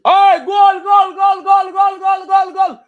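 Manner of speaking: high-pitched, repeated shouts of goal; real goal celebration. Real, not acted. Fan watching South Africa score, shouting in excitement. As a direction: erupt as your team scores, loud and very high.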